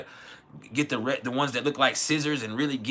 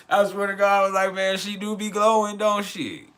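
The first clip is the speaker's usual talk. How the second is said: laughing; 6 semitones higher, 4 dB louder than usual. Real, not acted. Still laughing, retelling his reaction.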